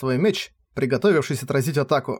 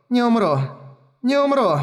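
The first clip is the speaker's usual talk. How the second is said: rising desperate repeat; 9 semitones higher than usual. Acted. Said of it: "повторяла она ... едва слышно".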